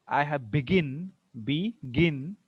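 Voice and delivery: "begin" with the weight on "gin"; stress on second syllable. Shown as a demonstration. Teacher showing verb stress, "begin" stressed on GIN. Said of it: "see the stress".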